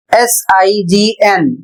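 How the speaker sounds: slow, letter by letter; spelling out letters. Shown as a demonstration. Teacher spelling a word for students, slow and clear. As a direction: spell it out slowly for the class. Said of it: "अगला शब्द है".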